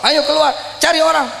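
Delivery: calling out. Acted. Voicing God's command to the Prophet.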